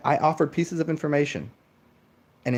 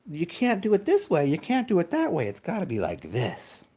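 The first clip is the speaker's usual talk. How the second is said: high, nitpicking scold; nagging mock scold; 5 semitones higher than usual. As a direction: lay down rules like a fussy prude. Mimicking rule-setting moderates.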